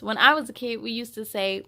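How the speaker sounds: ordinary delivery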